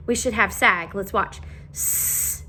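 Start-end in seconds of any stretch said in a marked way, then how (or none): 1.7-2.4 whispered sounding out. Shown as a demonstration. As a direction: whisper each letter softly. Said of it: "check our word".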